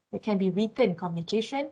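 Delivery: ordinary talk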